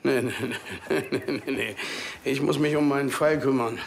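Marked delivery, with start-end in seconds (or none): 0.0-1.8 laughing refusal. Acted.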